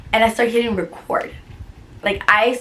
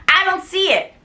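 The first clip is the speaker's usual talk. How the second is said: retold angry retort; 8 semitones higher than usual. Acted quarrel with the director.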